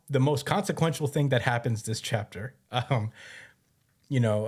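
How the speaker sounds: normal talking voice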